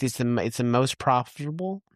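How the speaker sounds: normal talking voice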